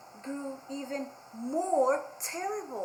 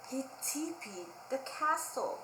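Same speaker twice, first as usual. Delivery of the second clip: ordinary delivery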